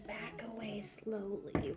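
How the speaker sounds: slow whisper. Acted. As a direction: breathe it out, slowly backing off. Sneaking away from creepy kids.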